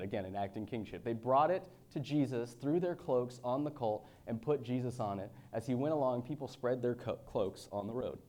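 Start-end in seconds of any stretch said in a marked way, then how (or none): 1.0-8.2 raised, louder preaching read; scripture reading. Acted. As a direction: proclaim the passage to the congregation. Reads scripture aloud.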